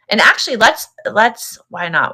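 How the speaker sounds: ordinary delivery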